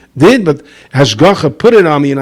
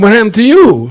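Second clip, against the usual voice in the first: amazed question; 10 semitones higher, 6 dB louder than usual. Retold or imitated. Congregants ask the man.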